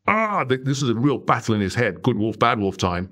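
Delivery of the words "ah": a sudden high strangled "ah"; anguished cry. Retold or imitated. Sam hesitating when asked to share his story, anguished high cry. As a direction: let out a pained, high-pitched groan of dread. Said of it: "the anguish as he was just like".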